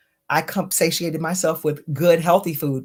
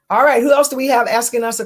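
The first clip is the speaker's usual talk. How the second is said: energetic reset; 6 semitones higher, 5 dB louder, faster than usual. Real, not acted. Switches to questions, energised.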